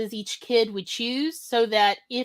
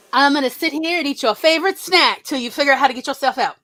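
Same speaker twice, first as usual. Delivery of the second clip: a fast, louder mock voice of mother; mom teasing; 4 semitones higher, 6 dB louder, faster than usual. Daughter imitating her mother taunting her with a snack, fast and loud. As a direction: tease the stuck kid like a playful mom, quick and loud. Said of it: "And she's like".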